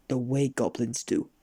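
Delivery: ordinary delivery, nothing marked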